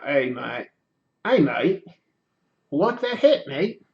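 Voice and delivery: attempting an Aussie accent, badly; haltingly practice a bad Australian accent; terrible Australian accent. Shown as a demonstration. Streamer practicing talking for viewers, terrible Australian accent. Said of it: "terrible Australian accent last episode. I was trying".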